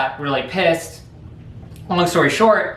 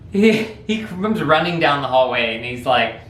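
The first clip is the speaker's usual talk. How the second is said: ordinary talk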